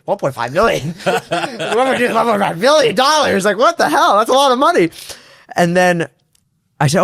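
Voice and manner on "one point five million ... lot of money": giggling, climbing higher and louder; can't hold back the laughter at the sum; laughing through disbelief at the money. Retold or imitated. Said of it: "In my head, I'm thinking".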